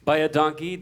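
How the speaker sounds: ordinary delivery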